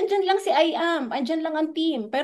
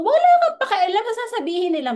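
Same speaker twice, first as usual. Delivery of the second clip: emphatic raised voice; 8 semitones higher, 4 dB louder, faster than usual. Real, not acted. Urging listener to ignore others.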